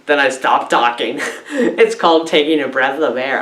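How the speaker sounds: usual speaking voice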